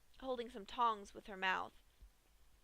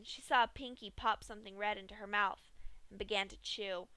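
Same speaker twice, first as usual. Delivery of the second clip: ordinary delivery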